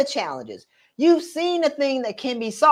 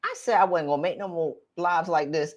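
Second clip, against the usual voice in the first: ordinary talk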